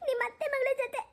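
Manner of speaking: put-on high, tearful young wife's voice, breaking into sobs; a hurt bride crying and accusing her husband; wife crying over the husband she thinks betrayed her; tearful new bride.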